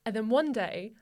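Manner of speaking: normal talking voice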